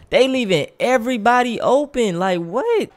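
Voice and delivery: high-pitched outraged disbelief; can't believe the defense. Real, not acted. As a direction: squeak out your shock. Shocked by open defense.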